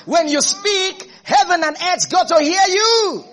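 slow build to a high, loud cry; soaring, loud preacher's climax; full-force preaching. Real, not acted. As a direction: proclaim it to the whole congregation.

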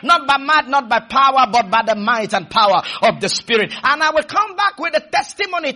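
fervent declamatory preaching; full-flow preaching. Real, not acted.

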